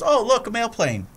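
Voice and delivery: put-on high, innocent childlike gasp; naive Ned impression. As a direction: point up at the sky like a wide-eyed innocent. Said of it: "and he goes".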